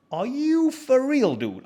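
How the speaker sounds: high, drawn-out disbelief; incredulous. Retold or imitated. Guest reacting to stubborn host, incredulous. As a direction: stretch it slowly upward in disbelief.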